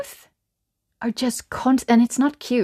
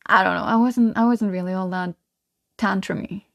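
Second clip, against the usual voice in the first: normal talking voice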